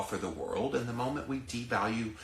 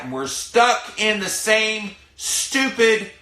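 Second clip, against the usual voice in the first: much higher and louder, stretched out in disgust; slow, loud, high frustrated cry; exasperated outburst; 11 semitones higher, 9 dB louder, slower than usual. Real, not acted. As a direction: spit out stupid, failed with real exasperation. Exasperated rant at failed advice.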